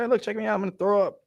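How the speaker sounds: high, goofy show-off boast; mocking show-off voice. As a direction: lampoon a bragging VR player. Mocking VR user bragging.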